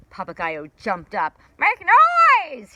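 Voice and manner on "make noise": very high, loud squawked order; shouted. Acted. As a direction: rouse the creatures with a shout. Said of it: "he shouted".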